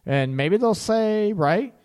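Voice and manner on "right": usual speaking voice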